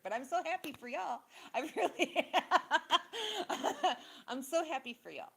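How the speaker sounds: high, loud, laughing forced cheer; laughing sarcasm. Real, not acted. Wishing viewers well through bitter laughter, high and loud. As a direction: laugh it out like you barely mean it.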